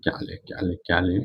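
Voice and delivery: whispered aside while searching for an example; half-whispered thinking aloud; a soft unvoiced mutter under the breath; muttering to himself. Real, not acted.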